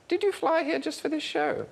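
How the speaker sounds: high, slow, lightly voiced queen. Comedian lightly voicing the Queen asking him a question. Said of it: "she actually said".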